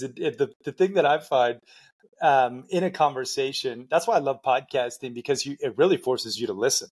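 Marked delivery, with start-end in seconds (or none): none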